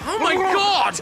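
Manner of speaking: high, loud cry of horror; horrified cry. Acted. Man realising his friend is drowning, loud horrified cry. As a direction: cry out in terror at the sight.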